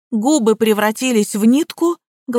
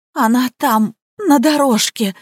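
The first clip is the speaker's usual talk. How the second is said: ordinary delivery, nothing marked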